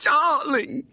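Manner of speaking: high, slow, melting cry of relief; tender mother. A narrator's reading. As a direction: draw it out lovingly to her found child. Mother recognises her lost daughter.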